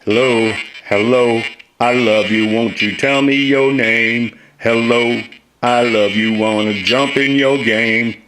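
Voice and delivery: playful test lines through the voice changer; testing vocoder. Shown as a demonstration. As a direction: voice through a vocoder, sing-song. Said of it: "I've got to do a little work to this".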